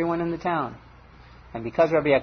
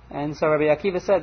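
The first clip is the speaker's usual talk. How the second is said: ordinary delivery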